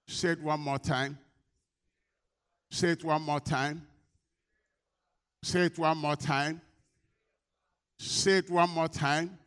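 loud, slow, insistent urging; drawn-out preacher's call, building in force; rallying call. Real, not acted. Preacher rallies congregation to repeat.

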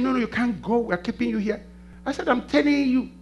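excited retelling. Real, not acted. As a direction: tell it with rising energy to the church. Preaching at full force.

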